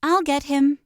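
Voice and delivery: a sister yelling she'll catch him. A narrator's reading. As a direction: call out to the family. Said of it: "Judy yells to her mom".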